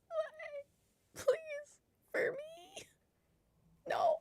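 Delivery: put-on a very high, soft baby-voice plea; mock pleading. Streamer begs the failing software in a tiny, very high pleading voice. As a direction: beg the computer like a pouting child.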